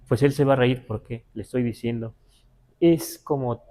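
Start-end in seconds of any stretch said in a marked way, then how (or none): none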